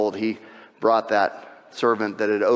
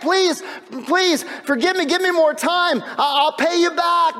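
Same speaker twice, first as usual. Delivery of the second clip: high, loud, pleading servant; begging; 3 dB louder, slower than usual. Acted. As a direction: beg desperately on your knees for more time. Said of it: "he begged".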